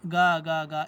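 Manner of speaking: loud squawking gull call gaga; seagull cackle. Shown as a demonstration. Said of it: "اس طرح، آ، چلائی".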